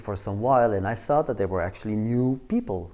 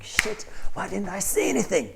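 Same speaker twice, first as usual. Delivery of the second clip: acted higher-pitched exasperation at oneself; self-reproach; 9 semitones higher than usual. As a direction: kick yourself for chickening out. Kicking himself after closing the door.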